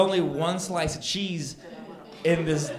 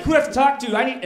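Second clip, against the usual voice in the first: a high, much louder demanding yell; demanding shout; 9 semitones higher, 7 dB louder, faster than usual. Retold or imitated. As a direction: shout to get a superior. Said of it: "I'm like".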